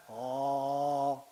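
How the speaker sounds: low sung ah. Shown as a demonstration. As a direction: sink the ah low. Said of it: "o grave". (ah cantado grave)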